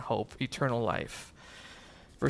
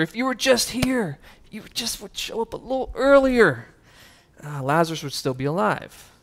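lightly voiced grieving Mary, higher; echoing her sister; 6 semitones higher than usual. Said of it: "like an echo, she just repeated her sister".

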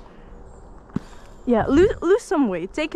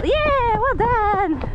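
excited praise; 11 semitones higher, much slower than usual. Real, not acted.

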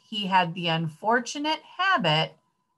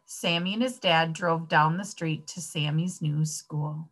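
ordinary delivery

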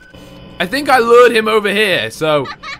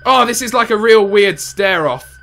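ordinary delivery

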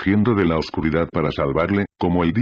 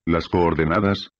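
ordinary delivery, nothing marked

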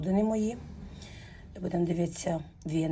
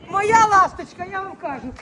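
ordinary delivery

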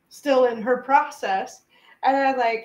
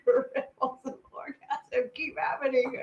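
ordinary talk

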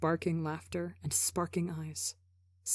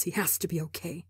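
desperate whisper; 4 dB quieter, faster than usual. A narrator's reading. Desperate inner thought, unvoiced.

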